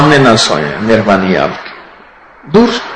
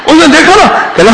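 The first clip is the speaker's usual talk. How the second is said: ordinary talk, nothing marked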